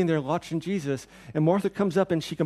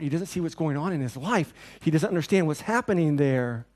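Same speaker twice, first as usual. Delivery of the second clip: usual speaking voice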